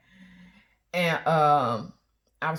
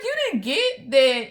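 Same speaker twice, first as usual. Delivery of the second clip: louder, slow disbelief; incredulous scolding; 5 semitones higher, 6 dB louder than usual. Retold or imitated. Wife tells husband he missed the flirting, louder and slow. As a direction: spell out that he missed it.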